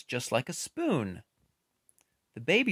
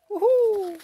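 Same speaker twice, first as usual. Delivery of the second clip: owl hoot; 9 dB louder than usual. Acted. Right after owls fly quietly.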